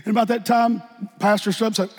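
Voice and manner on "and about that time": normal talking voice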